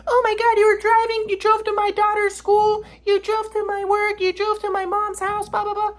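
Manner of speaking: high mocking falsetto imitation of Katie. Ex mocked as she lists where he drove, in falsetto. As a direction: whine it back in a high sneering voice.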